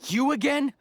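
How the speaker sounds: can't believe this pest is back; loud, high, fed-up you again; exasperated surprise. Acted. Rival scholar reappears.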